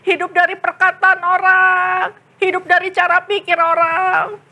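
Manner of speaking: high, slow sobbing repentance; tearful confession. Acted. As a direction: pour out regret while crying.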